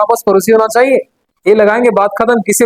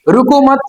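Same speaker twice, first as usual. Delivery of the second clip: रुको मत said as one unit, pitched high; pause after मत; 4 semitones higher, slower than usual. Shown as a demonstration. Teacher showing where the comma falls, pause after मत. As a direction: run रुको into मत, then stop. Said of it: "यहां तक comma".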